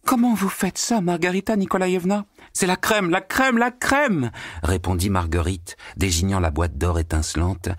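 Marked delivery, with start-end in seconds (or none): 0.1-2.2 astonished maid. A narrator's reading. Natasha voiced as character. 2.5-4.3 high, exultant voice, the cream repeated slow; a witch thrilled by her magic cream; delighted Margarita. A narrator's reading. Margarita showing Natasha the cream, high and exultant.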